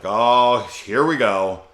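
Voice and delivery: loud, put-upon quoted groan of words; mock exasperation. Retelling character's exasperated reaction.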